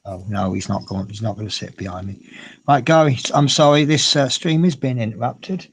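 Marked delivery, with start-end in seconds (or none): 2.7-5.7 louder apology. Real, not acted. Apologising to chat aloud.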